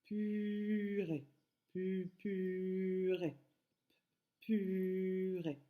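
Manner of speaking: "purée" with a long held "puuu" on one note; slow "puuu-rée", first vowel sustained; stretched first syllable. Shown as a demonstration. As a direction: draw out "pu" level, then drop onto "rée". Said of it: "C'est le son".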